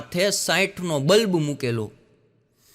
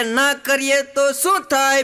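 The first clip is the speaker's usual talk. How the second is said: heckler's call; 7 semitones higher, 4 dB louder, slower than usual. Retold or imitated. Heckler shouts from the back.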